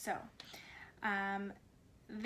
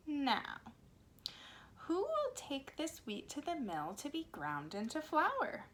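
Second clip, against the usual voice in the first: put-on slow, lively storybook reading for little kids; reading to children; 2 semitones higher than usual. A narrator's reading. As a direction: read it like story time on the carpet. Reads picture book to children.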